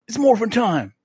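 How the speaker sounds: high announcer shout from a toy watch; toy voice line. Retold or imitated. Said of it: "and it says".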